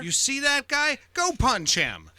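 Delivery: mock-tough command; bully giving orders. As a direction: order it like a cartoon tough guy. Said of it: "That's how they talk".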